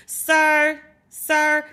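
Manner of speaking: calling him out, raising the voice; exasperated call. Real, not acted.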